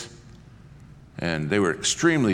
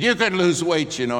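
much higher, louder snapping retort; exploding retort; 10 semitones higher, 6 dB louder than usual. Retold or imitated. Flight attendant exploding at the passenger, loud snapping. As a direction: fire it back, indignant. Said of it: "that's all it took".

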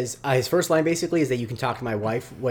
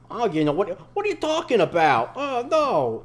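high, mock New York accent imitating mom; hammed-up New Yorker voice; mom's New York accent; 9 semitones higher, 4 dB louder than usual. Mom doing Jake in a story, New York accent. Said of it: "she'll put on a New York accent".

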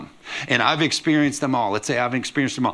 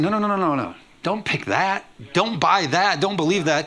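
higher, insistent no no no; urgent preaching; 5 semitones higher than usual. Acted. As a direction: plead hard against the lie. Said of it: "I said".

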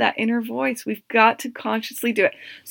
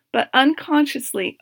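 usual speaking voice

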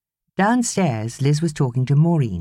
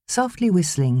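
ordinary delivery

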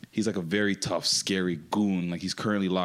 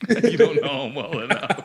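higher, louder, through laughter; laughing; 7 semitones higher, 7 dB louder than usual. Real, not acted. Repeating a friend's Florida man joke, laughing hard. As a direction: crack up while repeating the quip.

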